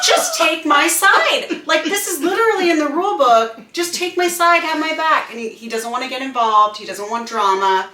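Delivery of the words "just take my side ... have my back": higher, loud, insistent "just take my side"; loud frustrated plea; exasperated demand. Retold or imitated. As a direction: beg your husband to back you up. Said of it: "I'm like".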